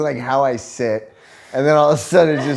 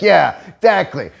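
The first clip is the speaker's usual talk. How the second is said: higher, louder hammed-up agreement; mock dad agreeing; 6 semitones higher, 5 dB louder than usual. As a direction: cheer along like a gleeful dad. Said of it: "my dad's like".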